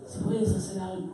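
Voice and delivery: soft, tentative plea; hushed, unsure request; doubting father. Acted. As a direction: ask it quietly, half-believing. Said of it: "El padre le dice a Jesús, ¿cómo?".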